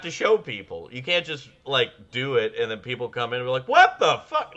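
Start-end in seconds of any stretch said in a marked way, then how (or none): 3.7-4.5 high, loud cry of disbelief; mock shock. Acting out people walking in on it, shocked shout. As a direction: yelp it like a stunned onlooker.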